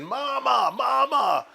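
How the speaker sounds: high calling shout of Mama, fading on the last words; yelling for Mom. Retold or imitated. Son calling his mother from the back, high shout. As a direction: call out for help across the kitchen. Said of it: "I started yelling for Mom".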